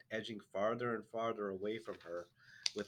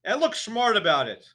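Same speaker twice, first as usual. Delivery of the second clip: high, very loud command; bellowing headmistress; shouted order; 12 semitones higher, 16 dB louder than usual. A narrator's reading. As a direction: shout him up to the stage. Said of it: "the Trunchbull shouted".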